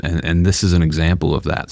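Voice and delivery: ordinary talk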